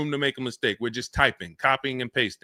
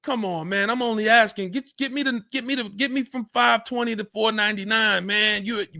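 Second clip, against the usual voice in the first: higher-pitched pleading haggler; mock begging, pitched up and insistent; 6 semitones higher than usual. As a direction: plead for a few dollars off, stumbling over yourself. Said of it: "feeling like you're begging".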